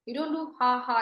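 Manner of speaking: ordinary delivery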